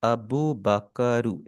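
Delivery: अबू बकरू said slowly with a final u on बकरू; teacher modelling the Japanese name; slow Japanese name demo. Shown as a demonstration. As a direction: stretch अबू बकरू out carefully. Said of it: "हो जाएगा बकरू".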